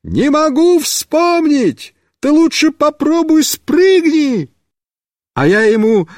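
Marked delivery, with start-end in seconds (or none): 0.0-4.5 high stretched calling shout. Acted. Mishka shouts advice to the rider, shouting. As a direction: yell advice from the side. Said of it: "Мишка снова кричит".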